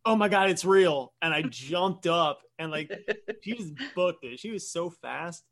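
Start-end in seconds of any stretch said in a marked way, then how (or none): 0.1-1.1 higher, louder mock alarm; imitated startled shriek. Girl discovering the werewolf is real, imitated alarm. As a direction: act out the girl's sudden fright.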